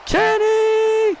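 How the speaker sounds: drawn-out, high winner announcement; ring announcer. Acted. Announcer naming the champion, booming. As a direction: stretch the name for the arena.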